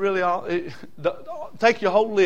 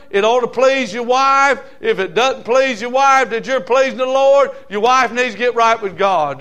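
louder, higher fired-up preaching; full-force preaching; 6 semitones higher, 5 dB louder than usual. Acted. Preacher exhorting husbands and wives to please the Lord, full force. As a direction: preach it hard to the pews.

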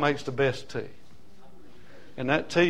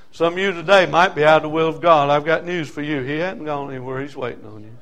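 ordinary delivery

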